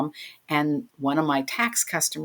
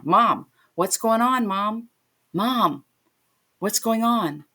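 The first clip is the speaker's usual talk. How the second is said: higher, slow worried calling out to mom; anxious plea into the phone; frantic calling; 5 semitones higher, slower than usual. Retold or imitated. Daughter calling her stricken mom, frantic and high.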